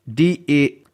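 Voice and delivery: ordinary talk, nothing marked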